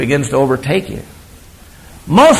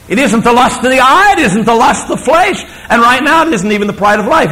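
preaching emphasis; 7 semitones higher than usual. Real, not acted.

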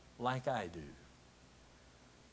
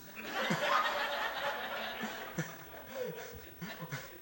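breathy laugh; 8 semitones higher than usual. Real, not acted. Laughing at own joke.

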